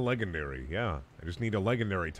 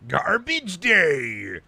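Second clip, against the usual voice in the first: high, loud, slow stretched yell; meme shout; 8 semitones higher, 7 dB louder, much slower than usual. Pretend. Streamer yelling a meme line, loud and drawn out. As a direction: bellow the meme catchphrase.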